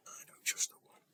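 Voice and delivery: hushed, confiding whisper; retold line in a near-silent whisper; whispered reply. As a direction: imitate him whispering it under the breath.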